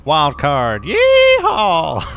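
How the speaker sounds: high, loud cowboy holler; whooping yell. Pretend. Player cheering a wild-card shot, whooping yell. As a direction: let out a soaring, drawn-out yeehaw.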